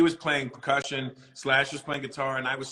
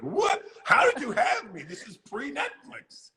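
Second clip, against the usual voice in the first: shocked disbelief; 11 semitones higher, slower than usual. Retold or imitated. Retelling shocked reaction to [name].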